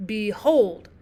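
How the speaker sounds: Behold with weak Be and strong HOLD; iambic stress. Shown as a demonstration. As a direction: lean on the second syllable of Behold. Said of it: "unstressed syllable, stressed syllable, iambic foot".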